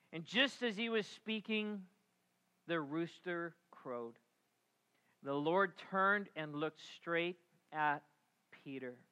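ordinary talk

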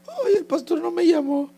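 high, mock-whining lament; whiny complainer. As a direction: imitate a sulky churchgoer feeling slighted. Mimics a complaining church member.

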